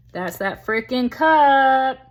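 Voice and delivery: loud, high, stretched out; excited emphasis. Real, not acted. Reader spotting that same cup on the card, loud excitement. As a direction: hammer it slowly, rising in volume.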